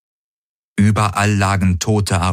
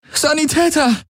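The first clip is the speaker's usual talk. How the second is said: calling out. A narrator's reading. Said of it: "rief sie".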